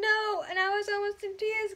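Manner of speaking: high, cracking voice; on the verge of crying; near tears. Real, not acted. Telling viewers how the ending hurt, near tears.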